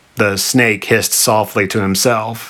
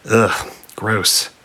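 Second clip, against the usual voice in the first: plain reading voice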